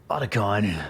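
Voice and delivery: low raspy Snake voice. Pretend. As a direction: growl the name low and raspy. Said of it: "Give me your best Snake impression".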